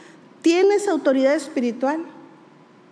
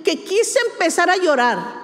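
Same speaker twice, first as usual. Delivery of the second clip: ordinary talk, nothing marked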